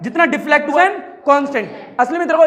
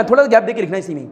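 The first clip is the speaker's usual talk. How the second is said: ordinary talk, nothing marked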